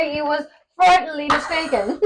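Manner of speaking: put-on high, hammy posh British accent. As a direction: play a pompous Brit.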